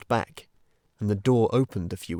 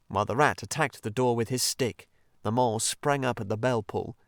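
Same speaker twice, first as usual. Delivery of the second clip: ordinary delivery, nothing marked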